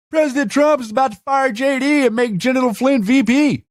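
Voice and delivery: high, hammed-up gullible believer voice; mocking Q believer. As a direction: parody a true believer gushing the news. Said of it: "Q-tards be like".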